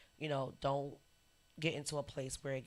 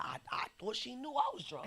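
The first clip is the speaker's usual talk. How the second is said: laughing; 9 semitones higher than usual. Retold or imitated. Laughing confession.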